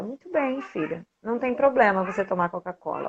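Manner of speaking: soft, tender reassurance to her daughter; reassuring mother. Retold or imitated.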